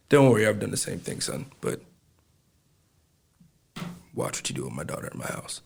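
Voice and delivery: low, very soft fatherly warning; girlfriend's father. Retold or imitated. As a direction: drop low and quiet as the father. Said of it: "he's like".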